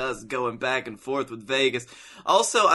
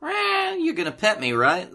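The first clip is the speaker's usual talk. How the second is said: cat voice; 7 semitones higher than usual. Retold or imitated. Voicing the cat.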